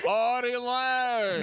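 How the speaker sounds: announcer call. Pretend. Co-host imitating Don Pardo hired to say Artie's name, drawn-out announcer call. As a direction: stretch the name out like a show intro.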